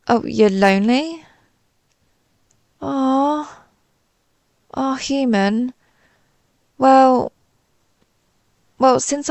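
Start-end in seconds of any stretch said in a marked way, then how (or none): 2.8-5.7 higher, tender "aw"; touched sympathy. Acted. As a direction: coo with sympathy for a lonely friend. Learns the human is lonely.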